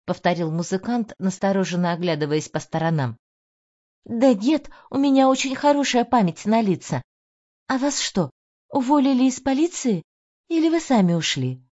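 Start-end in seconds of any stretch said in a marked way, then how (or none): 4.1-11.6 higher, eager insistence; lightly voiced young woman; young woman insisting. A narrator's reading.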